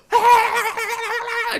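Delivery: high, loud, drawn-out mock scream; imitated scream. Neighbor screaming as soon as the door opens, mock scream. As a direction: mimic a neighbor screaming in your face. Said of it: "just screaming in my face".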